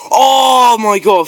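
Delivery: loud high drawn-out cry; shrieking jackpot winner. Real, not acted. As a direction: stretch it out, thrilled. Thinks it's a big prize.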